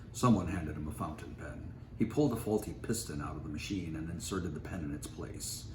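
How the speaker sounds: ordinary delivery, nothing marked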